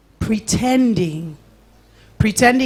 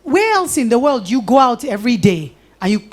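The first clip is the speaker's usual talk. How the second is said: usual speaking voice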